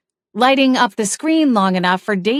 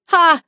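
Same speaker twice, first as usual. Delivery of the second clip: sharp mocking "Ha!", much higher and louder; scornful bark of a laugh; 10 semitones higher, 6 dB louder than usual. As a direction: spit out a contemptuous laugh.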